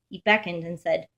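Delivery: ordinary delivery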